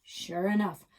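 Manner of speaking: usual speaking voice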